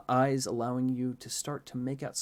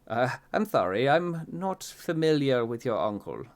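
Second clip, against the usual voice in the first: confused gentleman; 3 semitones higher, 6 dB louder than usual. A narrator's reading. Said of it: "He looks confused".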